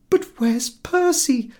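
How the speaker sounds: high, slow, tearful question; sobbing plea. A narrator's reading. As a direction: ask it through a sob. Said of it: "sobbed poor little Missus X".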